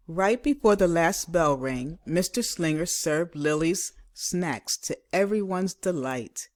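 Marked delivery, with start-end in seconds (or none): none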